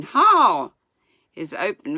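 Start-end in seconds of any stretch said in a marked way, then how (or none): 0.0-0.7 loud, high, drawn holler; yelled command. A narrator's reading. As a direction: yell the turn while tumbling.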